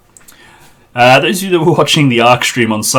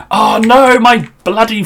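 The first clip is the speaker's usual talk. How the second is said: sudden high, louder cry of dismay; 10 semitones higher, 4 dB louder than usual. Real, not acted. Streamer discovering his rebuilt factory is gone, dismayed yelp. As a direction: yelp it as the loss sinks in. Finds factory lost after reboot.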